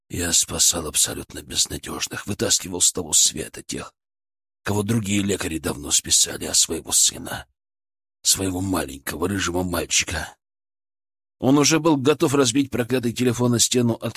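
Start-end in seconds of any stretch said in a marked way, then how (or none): 0.1-10.4 low, hushed, broken; quiet despair. A narrator's reading. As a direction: murmur it to yourself, crushed. Father's quiet despairing thought.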